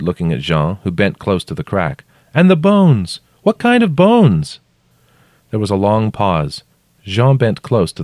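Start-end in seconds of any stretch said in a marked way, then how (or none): 2.3-4.6 high, loud shouted question; shouting. A narrator's reading. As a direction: call the question down, drawing out the end. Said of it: "I shouted".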